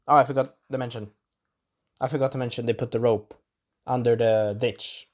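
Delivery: ordinary delivery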